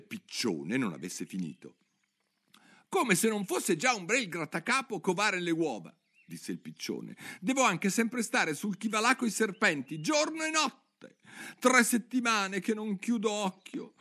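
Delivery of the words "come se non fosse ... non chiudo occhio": worried pigeon complaining on and on; fretful pigeon. Acted. (piccione ansioso che si lamenta)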